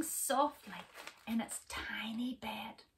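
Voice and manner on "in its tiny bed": ordinary delivery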